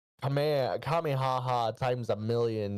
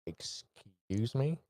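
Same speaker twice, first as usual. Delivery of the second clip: very slow, a touch quieter; slow excuse me; 4 dB quieter, much slower than usual. Acted. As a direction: drag it out, unimpressed. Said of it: "just goes".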